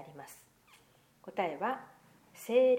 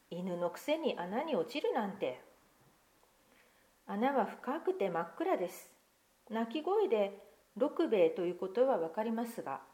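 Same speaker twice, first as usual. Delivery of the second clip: put-on lively picture-book reading to children; story narration; 4 semitones higher than usual. Pastor reading a picture book to children, lively storytelling. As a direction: read the tale aloud to the congregation, expressive.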